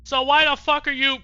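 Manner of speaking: high, loud outburst of disbelief; exasperated shout. Real, not acted. Player yelling at the Princess, exasperated shout. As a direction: blurt it out at full volume.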